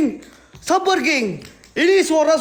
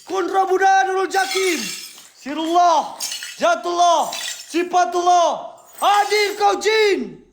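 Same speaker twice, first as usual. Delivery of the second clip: raised, slow commanding chant at the spirits; summoning incantation; 3 semitones higher, slower than usual. Acted. Ghost hunter summons the jinn, setan and iblis in a raised commanding chant. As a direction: call the jinn out like a shaman, higher and drawn out.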